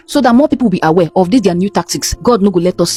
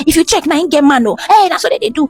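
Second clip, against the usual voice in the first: put-on elderly woman scolding; 8 semitones higher than usual.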